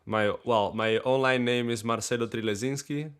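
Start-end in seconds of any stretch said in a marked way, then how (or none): none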